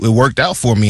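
ordinary talk, nothing marked

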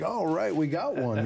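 high, cheering All right; excited friend. Retold or imitated. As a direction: celebrate a new convert. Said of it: "behind me was very excited".

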